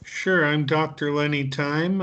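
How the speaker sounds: ordinary delivery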